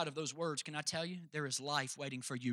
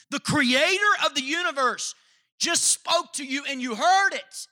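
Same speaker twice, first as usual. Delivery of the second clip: higher, louder, slow proclamation; preaching shout; 12 semitones higher, 15 dB louder, slower than usual. Acted. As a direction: proclaim it with full force, slowly. Preacher climax in retold words.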